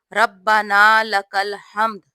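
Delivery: ربنا لک الحمد said slowly with a doubled b in ربنا; slow correct pronunciation. Shown as a demonstration. Teacher showing the correct pronunciation, slow and clear. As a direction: give ربنا لک الحمد full, measured syllables. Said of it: "یہ ہے صحیح تلفظ".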